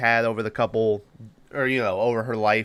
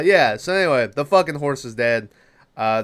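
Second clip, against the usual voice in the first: ordinary delivery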